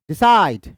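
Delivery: "decide" with light de, strong falling cide; stress on CIDE. Shown as a demonstration. Teacher repeats it for students, weight on cide in "decide". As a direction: hit the second syllable of "decide". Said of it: "unstressed और stressed है".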